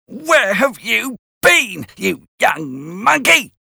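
hammed-up angry sister scolding; shouting sister. A narrator's reading. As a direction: Mrs Joe scolding Pip, mock shout. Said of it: "she shouted".

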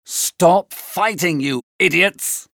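slow, stern call to stop; mock shout. A narrator's reading. Benvolio breaking up the servants' fight, restrained shout. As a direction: order them apart without full volume. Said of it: "Benvolio shouted".

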